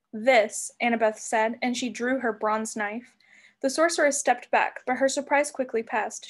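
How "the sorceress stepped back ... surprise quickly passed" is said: ordinary delivery, nothing marked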